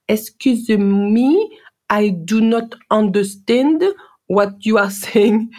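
put-on high, slow, careful French-accented English; struggling in French-accented English, slowly; mock-retelling her strong French accent, high and slow. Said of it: "my French accent was so, so strong".

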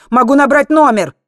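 loud, high, exasperated shout; irritated yell; barking. A narrator's reading. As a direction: bark out the offer. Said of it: "рявкнул Мартин".

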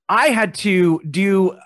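ordinary talk, nothing marked

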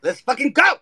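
loud hyped shout; excited cheer. Real, not acted. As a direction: cheer it out, pumped. Hype cheer.

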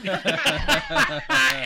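a high real laugh; real high laughter. Real, not acted. Teller laughing at the punchline.

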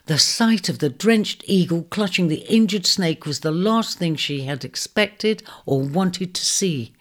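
plain reading voice

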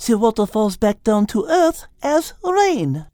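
put-on high French-accented teacher voice; french chef. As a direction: teach it like a cheerful French cook. Liz's lines.

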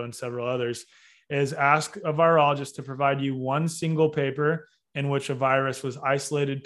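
ordinary talk

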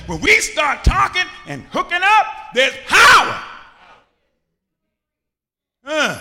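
loud, rising, shouted sermon cadence; full-force preaching. Acted. Pastor rousing the congregation, preaching at full force. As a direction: preach it hard to the gathered church.

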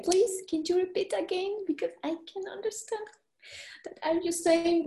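mock flustered asking them to say it again; a hint of embarrassed begging; retold plea to repeat.

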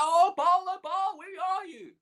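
very high, taunting call; hammed-up holler for a missing god; mock shout. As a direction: mimic desperate worshippers calling out. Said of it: "Maybe you need to shout".

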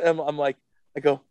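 usual speaking voice

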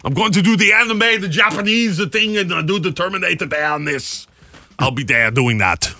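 mock Austrian action-star voice, starting high; high-pitched celebrity send-up, dropping off at the end; Arnold Schwarzenegger. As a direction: put on a comic Austrian strongman accent. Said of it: "it is rumored that Arnold Schwarzenegger will be an executive producer".

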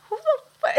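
high, cut-off indignant cry; indignant outburst. Retold or imitated. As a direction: fire it back high, stop mid-swear. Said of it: "I'm like".

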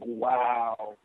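low, soft, drawn-back wow; stunned wow. Acted. Fan reacting to AJ's insult, stunned. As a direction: let it drop quietly, taken aback.

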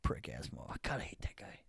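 muttered curse under the breath; real grumbling under the breath about a wrestler; whispered insult. Real, not acted. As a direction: hiss it under your breath.

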